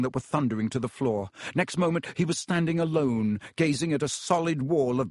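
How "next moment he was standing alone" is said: ordinary delivery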